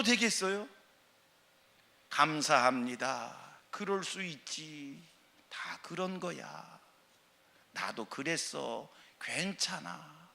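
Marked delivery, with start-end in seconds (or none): 2.1-10.3 slow, soft kind words; soft gentle reassurance. Shown as a demonstration. Preacher showing believers' kind speech, slow and soft. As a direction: comfort quietly, taking time. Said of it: "이 말이 전도가 되는 거예요".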